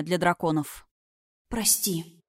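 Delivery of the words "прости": hushed, softer apology; soft near-whisper. A narrator's reading. Princess apologises mentally to her dragon, soft near-whisper. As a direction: say it gently, as a private thought. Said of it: "мысленно шепнула принцесса".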